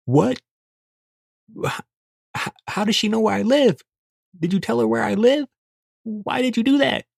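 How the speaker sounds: rising frantic accusation; panicked. Retold or imitated. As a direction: freak out as the car pulls up. Panicked questioning in bed.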